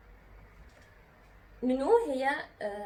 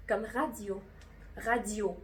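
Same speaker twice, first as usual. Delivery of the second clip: normal talking voice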